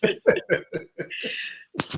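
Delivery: repeated through giggles; laughing. Pretend. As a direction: play innocent while laughing. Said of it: "I know nothing about that".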